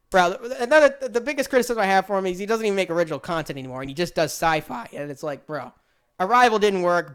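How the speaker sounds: ordinary delivery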